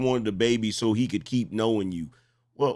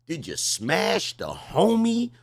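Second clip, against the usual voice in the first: slow suspicious question; 5 semitones higher, slower than usual. Real, not acted. Host interrogating caller.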